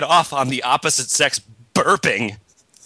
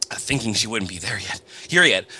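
ordinary talk

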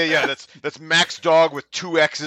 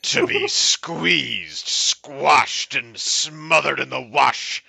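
slow, savoured poetry reading; mock-earnest, lingering verse delivery; poem recital; much slower than usual. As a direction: draw out each image as you recite. Reads a poem aloud.

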